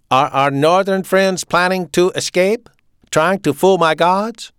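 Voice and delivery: loud, high German officer; authoritarian, punched-out German accent; staccato German accent. A narrator's reading. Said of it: "he spoke with a staccato German accent".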